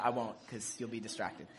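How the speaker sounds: usual speaking voice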